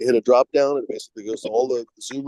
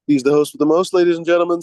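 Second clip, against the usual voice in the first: louder, higher game-show announcer call; big showman intro for the new host; announcer hype; 7 semitones higher, 6 dB louder than usual. Acted. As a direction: announce him like a ringmaster. Hyping the new host to chat.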